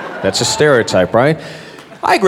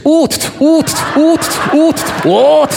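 short, high repeated "oot" noises; high imitated hoots; 11 semitones higher, slower than usual. Mimicking tourists always taking pictures, high repeated hoots.

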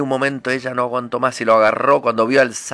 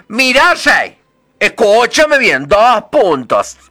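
complaining frog; 9 semitones higher, 7 dB louder, slower than usual. Acted.

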